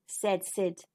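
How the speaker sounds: ordinary delivery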